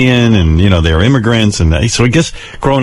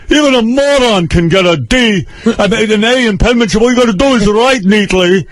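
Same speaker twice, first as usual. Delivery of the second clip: lightly voiced stern father, higher pitched; mock the father scolding in a higher voice; father's voice; 11 semitones higher than usual. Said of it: "He goes".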